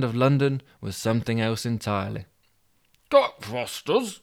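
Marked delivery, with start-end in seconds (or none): 3.1-4.2 high, slow, drawn-out cry of Cockfosters; Yorkshireman's surprised shout. Acted. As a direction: call out Cockfosters, higher and stretched. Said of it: "he shouted".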